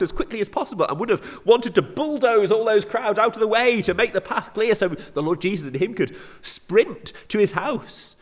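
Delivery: high, loud, breathless-paced rush; urgent haste. Acted. Preacher conveys a father desperate to reach his house, urgent rush. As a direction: race through it at high pitch, pressing forward.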